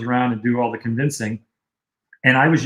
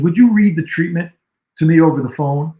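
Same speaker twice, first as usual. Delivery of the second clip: mimicking Bobby's request; 5 semitones higher, 6 dB louder than usual. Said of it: "He goes".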